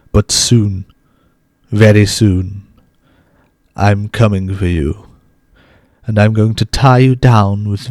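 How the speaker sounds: slow, drawn-out theatrical menace; cartoon vampire. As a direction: read it like a creepy cartoon vampire. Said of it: "read it like a cartoon vampire".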